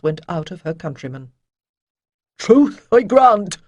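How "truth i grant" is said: slow, higher, louder plea; desperate. Acted. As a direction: grasp at a last argument. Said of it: "said Avon desperately".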